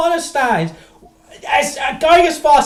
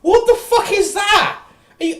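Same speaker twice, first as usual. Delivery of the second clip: disgusted disbelief; 10 semitones higher than usual. Real, not acted. Reacting to drink.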